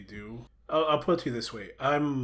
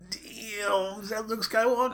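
high-pitched, drawn-out retold amazement; amazed reaction; 11 semitones higher, 4 dB louder, slower than usual. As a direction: relive the shock of seeing a hero appear. Retelling his amazed reaction to reveal.

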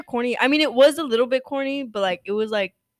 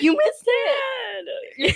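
high, crying mock shout; upset yell; 13 semitones higher, much slower than usual. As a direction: whine it like an annoyed kid.

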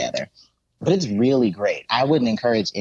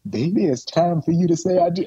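higher, sweet mother's coaxing through laughter; coaxing mom; 7 semitones higher than usual. Retold or imitated. Mother urging her daughter at the wedding, coaxing lightly. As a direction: nudge her like a mom at the altar. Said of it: "Her mom is like".

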